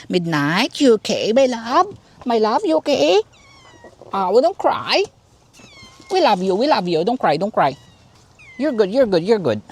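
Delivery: cooing comfort. Real, not acted. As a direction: fuss lovingly over a crying cat. Comforting her crying cat.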